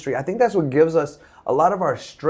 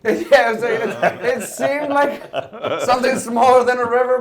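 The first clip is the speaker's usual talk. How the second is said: laughing; 8 semitones higher, slower than usual. Real, not acted. Laughs at being corrected.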